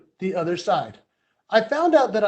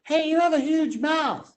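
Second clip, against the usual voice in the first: higher-pitched imitation of a kid calling out; mock schoolkid voice, pitched up; kid on bus; 7 semitones higher than usual. Speaker imitating a kid at the back of the school bus, high kid voice. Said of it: "this kid in the back said".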